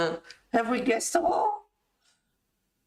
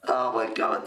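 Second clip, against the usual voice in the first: normal talking voice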